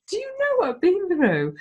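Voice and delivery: pitched high and outraged; indignant retort. Retold or imitated. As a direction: fire it back at the critics, indignant. Retorting to critics about her body.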